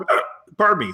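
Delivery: talking through hiccups; hiccuping between words. Real, not acted. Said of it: "Sounds like your dog's barking".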